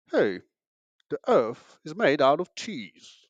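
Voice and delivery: put-on British accent, drawn out slowly. Shown as a demonstration. Comedian showing how an English accent makes nonsense sound smart, mock British accent. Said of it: "saying dumb shit with English accent".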